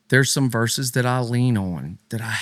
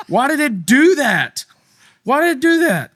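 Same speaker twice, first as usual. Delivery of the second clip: much higher and louder, baffled and annoyed; exasperated surprise; 12 semitones higher, 5 dB louder than usual. Real, not acted. Host to cohost while his Bible app switches versions, high exasperated surprise. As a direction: demand an answer from a glitching phone.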